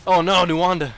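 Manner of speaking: loud, high cry of dismay; excited shout. Real, not acted. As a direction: yell it as the play collapses. Big play in commentary.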